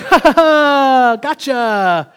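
high, slow teasing "ha ha ha"; playful mock laugh. Dad throwing a doll at his son, playful taunt. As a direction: gloat playfully with a drawn-out put-on laugh.

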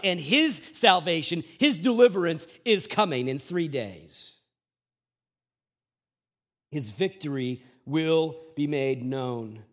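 normal talking voice

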